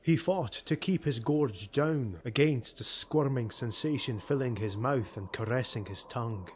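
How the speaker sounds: usual speaking voice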